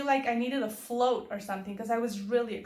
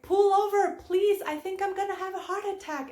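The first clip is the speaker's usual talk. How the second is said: urgent shout; 8 semitones higher than usual. Retold or imitated. Chasing the driver, shouting at him to pull over. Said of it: "And I'm like".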